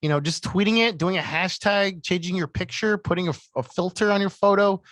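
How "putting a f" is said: normal talking voice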